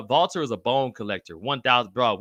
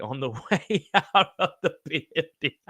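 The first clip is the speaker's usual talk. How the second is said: laughing while reading; 3 semitones higher than usual. Real, not acted. Host cracking up while reading a chat message aloud. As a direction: laugh breaking through the words.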